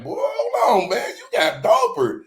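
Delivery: high, squeaky mock voice of the big homies; impressed homies. The big homies react to his improved freestyle, high mock voice. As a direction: pitch way up to play the amazed older guys. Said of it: "they like".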